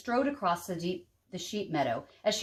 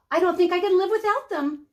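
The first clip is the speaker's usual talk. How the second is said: eager; 9 semitones higher, 5 dB louder than usual. A narrator's reading. Kate marvels at the beans.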